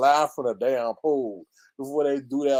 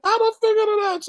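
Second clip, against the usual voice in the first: excited; 3 dB louder than usual. Real, not acted. Triumphant exclaim after laugh.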